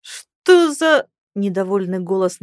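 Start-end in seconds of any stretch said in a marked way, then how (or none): 0.0-1.1 sharp indignant cry, high and loud; angry outburst. Acted. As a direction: bark it out in outrage. Stranger startled and angry. (возмущённый возглас)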